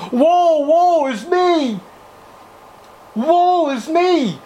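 high, slow wailing lament; anguished sinner. Acted. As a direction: wail it out like a lost sinner crying woe. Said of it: "what does he say?".

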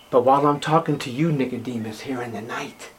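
Jesus confiding to Nicodemus at night, fading soft; fading hush. Acted. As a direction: let the words die away into a hush. Preacher voices Jesus to Nicodemus.

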